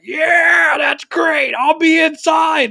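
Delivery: high, drawn-out holler; mock excited yell. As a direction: imitate a friend hollering back, stretching the words. Retold drunk friend calling out.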